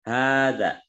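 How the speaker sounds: "Hadza" with the first a drawn long, haa-dza. Shown as a demonstration. Teacher showing students the lengthened ha vowel. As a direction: stretch the ha of "Hadza". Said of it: "dibacanya panjang".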